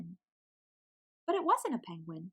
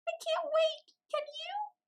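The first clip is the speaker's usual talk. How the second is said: much higher, squeaky chick voice; squeaky little penguin. A narrator's reading. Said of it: "she squeaked".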